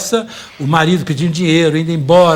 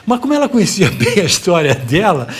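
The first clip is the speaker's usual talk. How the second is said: usual speaking voice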